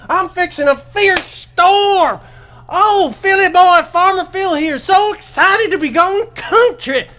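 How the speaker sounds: high, loud hammed-up hillbilly voice. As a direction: ham up a country yokel, whooping. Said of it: "chewing the fat Pat".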